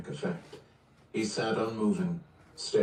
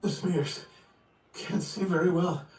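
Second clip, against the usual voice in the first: high, gasping cries of pain; panicked, in pain; 10 semitones higher than usual. Acted. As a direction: fight for breath as it burns. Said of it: "breathing was coming in ragged gasps".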